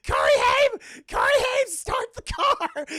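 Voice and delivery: very high, loud calling out; mock panicked scream. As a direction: scream for help like a fleeing mother. Voicing mother fleeing, screaming to son.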